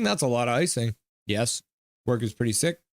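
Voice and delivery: ordinary talk, nothing marked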